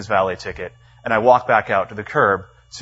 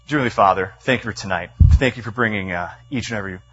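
lower, subdued praying voice; prayer; 4 semitones lower than usual. Real, not acted. Leading the room before the text, in low prayer. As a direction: pray aloud in a low, humble tone.

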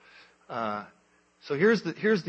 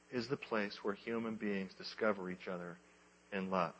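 ordinary talk

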